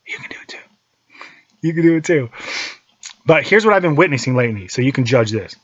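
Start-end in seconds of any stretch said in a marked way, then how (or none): 0.1-0.6 soft unvoiced whisper. Encouraging listeners to follow him, intimate whisper. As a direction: lean in and whisper it. Whispered then repeated plainly.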